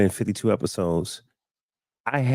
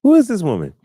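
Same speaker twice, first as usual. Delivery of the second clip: higher, louder, amazed repeat; mock amazement; 9 semitones higher, 5 dB louder, faster than usual. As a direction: exaggerate your astonishment. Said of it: "I was like".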